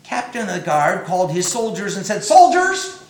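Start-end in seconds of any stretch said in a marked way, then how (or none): 2.2-3.0 loud, high shouted call. Acted. The captain calling his soldiers, high loud shout. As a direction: summon the troops at full voice.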